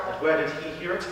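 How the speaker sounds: low, soft confessor's question; probing priest. Acted. Priest questions Frankie about Mikey, low and soft. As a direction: quietly dig for the source.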